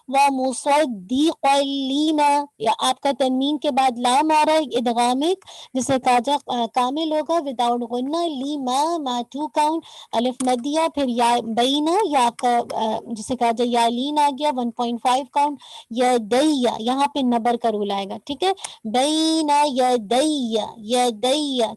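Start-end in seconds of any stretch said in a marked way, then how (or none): 0.1-2.5 drawn out slowly, each letter held; slow recitation demo. Shown as a demonstration. Teacher reciting slowly to show the student. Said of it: "इस अंदाज से". 18.9-20.7 "दैया" slow, voice lifted on the doubled ya; elevated doubled ya. Shown as a demonstration. Teacher shows the student the lifted ya. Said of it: "थोड़ा सा आवाज़ आपकी elevate करेगी".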